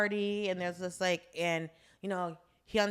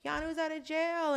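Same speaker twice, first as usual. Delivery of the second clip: mock gushing; 9 semitones higher than usual. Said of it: "It's like".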